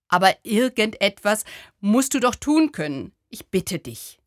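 a touch of despair, pressing then fading; desperate. Acted. To the little Buddha, a man's mildly desperate plea. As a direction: plead as if it's the last hope. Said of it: "Aus seiner Stimme klang Verzweiflung".

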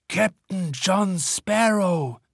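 very slow, dark pirate boast; theatrical pirate announcement. A narrator's reading. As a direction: announce yourself like a pirate captain. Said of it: "setzt demonstrativ einen finsteren Blick auf".

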